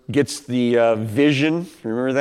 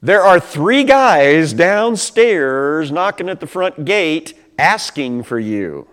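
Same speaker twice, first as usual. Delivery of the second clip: Holy Spirit speaking; 2 semitones higher, 5 dB louder, slower than usual. Retold or imitated. Holy Spirit instructs Peter, slow and solemn. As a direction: announce it slowly, like a voice from above. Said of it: "the Holy Spirit says".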